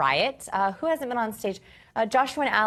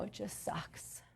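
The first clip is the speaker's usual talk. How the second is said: relieved whisper; 18 dB quieter, slower than usual. Acted. Partner finally calms down, relieved whisper. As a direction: whisper it out after the sigh, slowly. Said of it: "final relief breath".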